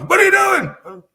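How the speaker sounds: high, loud shouted challenge; angry yelling bar owner. Retold or imitated. Bar owner yelling at a rowdy customer, high shout. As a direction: yell it across the bar. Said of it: "yelled at by the bar owner".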